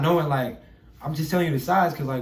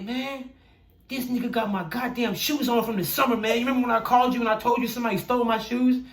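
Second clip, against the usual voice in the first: retold outraged complaint; 8 semitones higher than usual. Telling mentor about stolen shoes.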